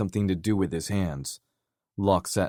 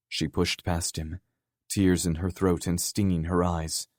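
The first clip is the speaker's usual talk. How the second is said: usual speaking voice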